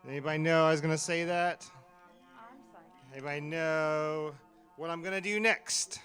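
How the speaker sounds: hyped host working the crowd; in front of an audience. Acted. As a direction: project out to a live crowd like an MC. Host hyping a live audience.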